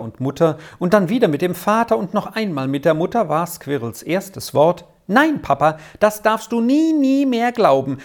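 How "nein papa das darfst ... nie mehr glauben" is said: put-on high, eager child's insistence; emphatic protest. A narrator's reading. Squirrel defending the professor to Papa, emphatic eager protest. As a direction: insist eagerly, pitching up on the denials. Squirrel excitedly contradicts her father.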